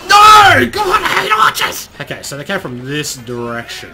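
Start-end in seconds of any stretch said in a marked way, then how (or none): 0.1-1.9 very high, loud outburst; loud wail of gamer rage; frustrated yell. Real, not acted. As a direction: scream it in exasperation at a cheap death. Killed by archers.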